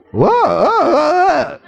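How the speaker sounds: rising hammed-up whoa, pitch climbing high; a playful exaggerated whoa at the girls; mock whoa. Mocking boys' reaction.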